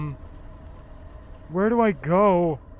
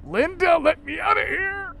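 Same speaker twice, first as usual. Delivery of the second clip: high, desperate call; pleading yell; 12 semitones higher than usual. Real, not acted. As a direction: cry out to be freed. Yelling to be let out.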